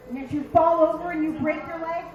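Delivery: ordinary talk, nothing marked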